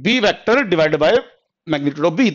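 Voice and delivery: "B vector divided by magnitude of B" drawn out very slowly, word by word; slow dictation. Shown as a demonstration. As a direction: say it slowly while writing on the board. (Board पर लिखते हुए धीरे धीरे लिखवाना)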